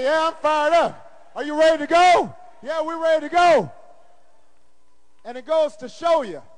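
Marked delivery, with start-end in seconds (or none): 0.0-3.7 high, ringing imitated call and response; fired-up exchange. Staff joining in all day long, high chanted replies. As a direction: answer it like a rallying cry. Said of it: "They say".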